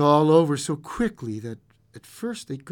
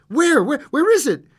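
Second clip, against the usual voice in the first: high, loud startled stammer; alarmed; 13 semitones higher, 6 dB louder than usual. A narrator's reading. Husband startled awake by wife's cry, alarmed. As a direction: jolt awake in a panic. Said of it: "He started up in alarm".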